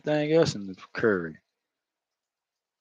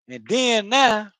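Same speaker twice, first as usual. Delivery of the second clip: high, slow Florida drawl on "then now"; Florida accent; 9 semitones higher, 3 dB louder, much slower than usual. Shown as a demonstration. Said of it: "that's how we talk in Florida".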